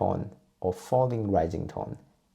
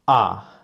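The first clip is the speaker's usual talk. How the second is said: loud "ah" falling all the way down; a sudden "ah" of remembering, pitch dropping; 8 dB louder than usual. Shown as a demonstration. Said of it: "like you just remember something".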